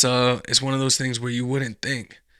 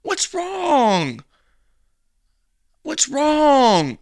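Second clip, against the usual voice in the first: high, loud mocking voice of the man; imitating a fake-concerned guy, higher; mock gaslighting man; 13 semitones higher, 8 dB louder, much slower than usual. Mocking the man who pretends nothing happened, high and loud.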